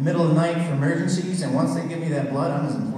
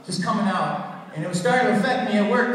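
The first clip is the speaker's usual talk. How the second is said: ordinary talk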